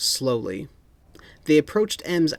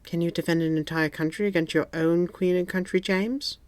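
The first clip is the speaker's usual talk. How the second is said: sheepish; 5 semitones higher than usual. A narrator's reading. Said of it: "Moneypenny said sheepishly".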